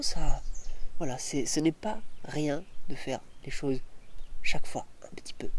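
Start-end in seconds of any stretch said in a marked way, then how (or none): 4.4-5.5 quick whisper. Real, not acted. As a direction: hurry it in a whisper. Whispered emphasis.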